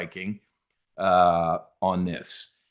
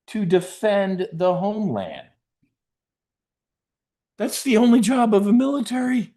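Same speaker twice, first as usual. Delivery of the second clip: much higher and louder, hammering the point; rising into an exasperated shout; heated rant; 10 semitones higher, 4 dB louder than usual. Real, not acted. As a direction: yell it like the obvious truth. Ranting about the military's job.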